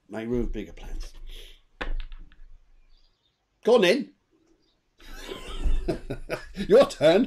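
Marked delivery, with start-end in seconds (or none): 5.1-6.6 high laugh. Real, not acted. Hands over after reading.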